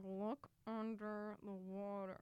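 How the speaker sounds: slow, soft, frightened warning; scared hush. Retold or imitated. Snorkeler warning her brother of a shark, slow scared hush. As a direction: whisper the danger slowly. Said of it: "I'm like".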